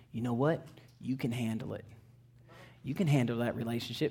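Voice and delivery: low, soft coaxing voice; tempting devil. Acted. Devil tells believers they can handle it, soft coaxing. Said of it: "that devil will start telling you".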